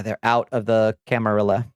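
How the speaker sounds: ordinary delivery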